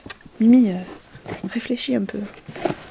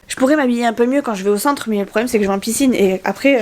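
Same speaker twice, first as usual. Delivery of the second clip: ordinary delivery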